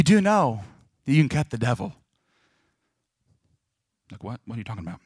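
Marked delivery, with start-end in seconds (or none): none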